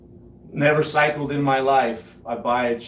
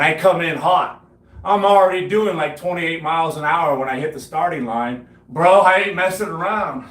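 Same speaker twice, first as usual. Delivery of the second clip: excited; 5 semitones higher, 5 dB louder than usual. Retold or imitated. Hyped retelling of speeding in.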